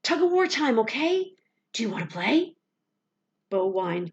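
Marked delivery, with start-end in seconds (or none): 0.0-2.6 high, loud playful coaxing. A narrator's reading. Boy coaxing his trapped dog to play tug. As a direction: lure the dog into his favourite game.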